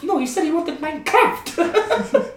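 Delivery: very high, squawky retold argument; hammed-up high-pitched correction; mock indignant protest. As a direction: play both sides in a high comic voice. Acting German meme argument.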